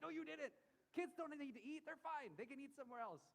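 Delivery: high, strained mock panic, well above his voice; mock panicked protest. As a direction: squeak out a frantic overprotective refusal. Retold panic over car snacks.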